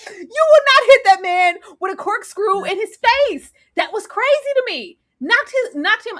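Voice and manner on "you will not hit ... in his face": ordinary talk, nothing marked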